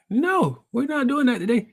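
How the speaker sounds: high, no-nonsense retort; insistent therapist. Retold or imitated. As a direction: imitate a coach who won't give in. Acted therapist refusing to push him.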